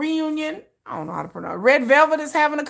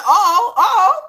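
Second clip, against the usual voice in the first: shocked cry; 6 semitones higher, 7 dB louder than usual. Real, not acted. Shocked at a fight video.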